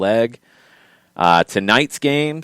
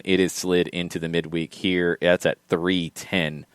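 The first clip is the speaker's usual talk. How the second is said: usual speaking voice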